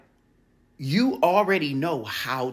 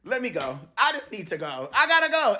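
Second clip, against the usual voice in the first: building urgency; 8 semitones higher than usual. Acted. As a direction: build from restraint to an urgent burst. Acted escape from argument, building.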